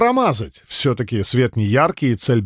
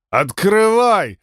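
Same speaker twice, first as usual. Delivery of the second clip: put-on slow, slurring drunk yell, a bit higher; slurred drunk shout; 7 semitones higher, much slower than usual. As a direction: bang on the door like a drunk. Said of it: "заплетающимся языком, изображая пьяного, закричал я".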